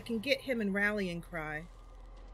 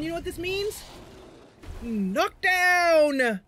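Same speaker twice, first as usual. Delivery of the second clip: high, loud cry of triumph; triumphant shout; 8 semitones higher, 8 dB louder, slower than usual. Real, not acted. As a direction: announce the win loudly. Big break in combat.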